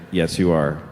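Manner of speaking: very low, certain deadpan; low, immovable contradiction; deadpan menace. Retold or imitated. Seven-year-old to the kid denying he'll die, deadpan menace.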